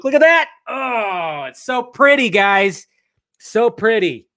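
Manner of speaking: high, loud gushing over the art; gushing excitement. Real, not acted. Gushing to viewers over the pretty comic art. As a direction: swoon over something pretty.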